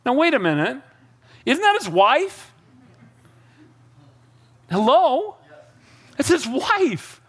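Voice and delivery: high, drawn-out comic indignation; mock disbelief. Preacher pointing out Saul insulted his own wife, mock disbelief.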